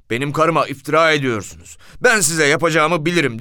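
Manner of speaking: loud, high threatening snap; angry outburst. Retold or imitated. Husband threatening the police, loud angry outburst. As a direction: lash out at the officers. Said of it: "diye çıkıştı".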